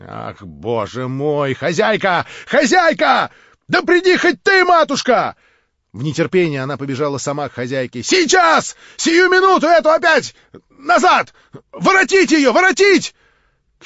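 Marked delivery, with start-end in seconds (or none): 0.1-5.3 нарастающий крик, очень высоко и громко; зови хозяйку во весь голос; отчаянный зов. Acted. Варвара в панике зовёт хозяйку. 8.0-13.1 очень громкие высокие приказы; командуй на весь дом; властный крик. Acted. Варвара приказывает вернуть.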